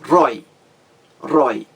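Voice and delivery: RÓI said alone and punched; "rói" as one firm, separate beat; stressed syllable shown. Shown as a demonstration. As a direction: isolate "rói" as the strong syllable. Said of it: "A mais forte".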